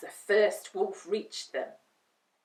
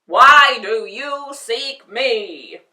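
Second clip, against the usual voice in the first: slow, loud booming voice; booming giant head; 4 semitones higher, 17 dB louder, slower than usual. Acted. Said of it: "boomed a giant head".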